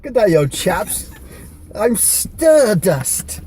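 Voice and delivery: high, slow, hammed-up British wrestler voice; theatrical high-pitched invitation; Stardust. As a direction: draw it out in a strange, lofty British voice. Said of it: "I need an impression of you being Stardust".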